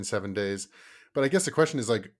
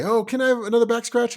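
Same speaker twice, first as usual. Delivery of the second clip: put-on whiny kid voice; 14 semitones higher, 10 dB louder than usual. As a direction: beg like a sleepy kid stalling bedtime. Kid asking for more.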